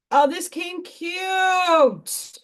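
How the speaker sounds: high, slow, delighted exclaim; pleased with how her craft turned out, high and drawn out. Real, not acted. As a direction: gush over it.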